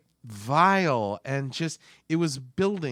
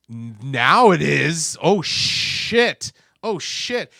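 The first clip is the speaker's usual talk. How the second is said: shocked reaction; 9 dB louder, much slower than usual. Retold or imitated. Reacting to the film in theater.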